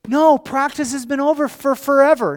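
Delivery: put-on loud, high child's wail; hammed-up bawling of a wronged kid; mock weeping. Weeping son complains practice ended long ago, loud imitated bawl. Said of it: "He's weeping".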